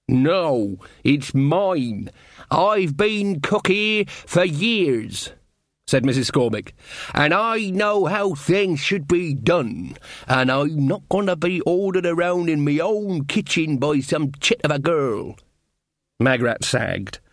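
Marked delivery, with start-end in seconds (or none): 0.1-5.3 higher, very slow, insistent cook voice; indignant cook. Acted. Cook defending her kitchen to the queen, indignant. 7.1-15.4 higher, very slow, insistent cook voice; indignant cook. Acted. Cook defending her kitchen to the queen, indignant.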